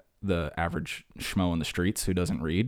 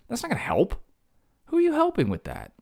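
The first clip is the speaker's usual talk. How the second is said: much higher, incredulous protest; indignant high rant; 3 dB louder than usual. Real, not acted. Rejecting being slapped during a freak out, high indignant protest. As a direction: push it up in outrage.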